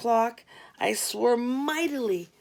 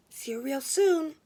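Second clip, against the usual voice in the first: lightly voiced Glenda; 5 semitones higher than usual.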